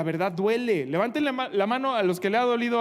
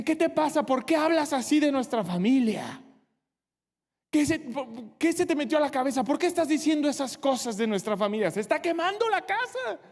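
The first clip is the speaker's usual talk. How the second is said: más agudo, reprochando con indignación; reproche indignado; 5 semitones higher than usual. Acted. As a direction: como familia ofendida que regaña. Familia reprocha al valiente. (la familia regaña al valiente, indignada y aguda)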